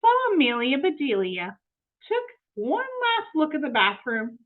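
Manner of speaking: ordinary delivery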